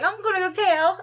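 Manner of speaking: put-on high, loud tattling little kid; kid nephew voice. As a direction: imitate a little boy threatening to tell on you. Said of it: "where he be like".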